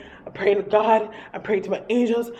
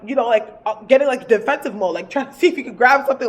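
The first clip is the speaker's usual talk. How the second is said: usual speaking voice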